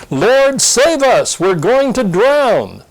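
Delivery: high, pleading cry for rescue; frightened plea. Acted. Disciples begging Jesus in the storm, frightened and high. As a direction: beg to be saved from drowning.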